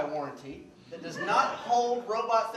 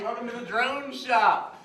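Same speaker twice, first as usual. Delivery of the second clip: slow, stiff robot welcome; 3 semitones higher, 6 dB louder, slower than usual. Acted. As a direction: sound like a machine. Robot greets customer.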